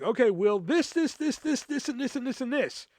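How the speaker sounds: high-pitched manager reeling off tasks; mock rattled-off to-do list; demanding manager. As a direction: pile on the orders in a lifted voice. Manager voiced piling on tasks.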